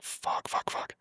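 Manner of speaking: voiceless cursing under the breath; hushed, repeated curse; panicked whisper. Retold or imitated. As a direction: whisper the swearing to yourself. Retold panic as sight closes in.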